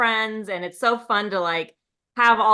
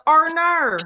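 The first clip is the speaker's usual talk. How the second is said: exaggerated, higher Australian "oh no"; Australian accent; 6 semitones higher than usual. Shown as a demonstration. Showing off her one Australian phrase, hammed-up accent. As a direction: stretch the vowels in a mock Australian accent. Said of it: "as your Australian accent".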